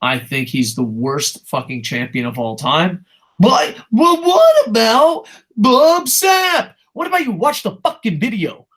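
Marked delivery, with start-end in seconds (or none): 3.4-8.7 high, dragged-out mock whining yell; mocking shout. Streamer mimicking commenters who argue about worst champion, mocking shout. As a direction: shout it in a silly high voice, stretched out.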